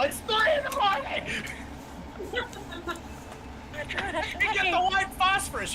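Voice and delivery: angry neighbour yell. Acted. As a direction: yell through the wall. Banging on the noisy neighbours' wall.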